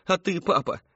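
high-pitched appeal; imploring. A narrator's reading. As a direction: ask your father pleadingly. Tearful daughter appeals to father.

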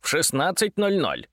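gruff grumble through a receiver; grumbling telephone. A narrator's reading. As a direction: grumble it like an old phone. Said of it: "буркнула трубка".

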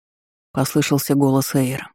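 ordinary delivery, nothing marked

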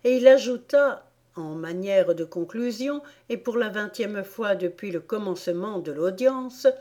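usual speaking voice

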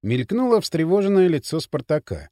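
usual speaking voice

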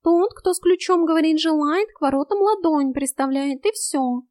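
high-pitched cat voice; high, insistent explanation; urgent cat. A narrator's reading. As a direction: lay out the rules as a cat.

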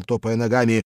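ordinary delivery, nothing marked

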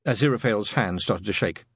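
plain reading voice

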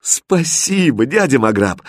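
happy, stretched-out thank you; delighted thanks. A narrator's reading. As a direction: thank your uncle with boyish joy. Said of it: "обрадовался Аладдин".

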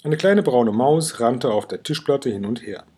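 ordinary delivery, nothing marked

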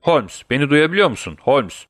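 mildly urgent wake-up tone; insistent rousing. A narrator's reading. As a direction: call as if trying to wake a sleeper. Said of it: "uyuyan bir insanı kaldırmaya çalışan birinin ısrarlı ses tonuyla".

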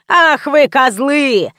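drawn-out, mildly yelled outburst; yelled insult. A narrator's reading. Люся scolding the drunken men, a slow mock yell. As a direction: yell it slowly, stretching the words, without full force. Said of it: "заорала Люся".

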